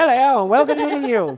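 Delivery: put-on high, fast old-time newsreader; 1940s newsreader. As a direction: read it like a 1940s radio newsman. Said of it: "I've never met people who actually speak like that".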